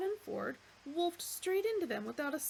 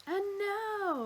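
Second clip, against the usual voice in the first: showman announcer; 11 semitones higher, 5 dB louder than usual. Acted. Max announces.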